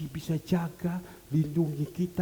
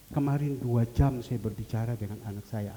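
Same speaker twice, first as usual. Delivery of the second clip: ordinary talk, nothing marked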